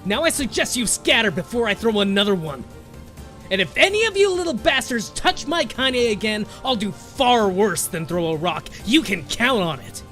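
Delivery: put-on high, loud, furious old woman; voice thick with rage. A narrator's reading. Grandmother warning the bullies off, furious and loud. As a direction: threaten them with fury. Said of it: "in a voice thick with rage".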